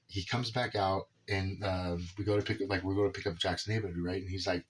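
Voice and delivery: ordinary delivery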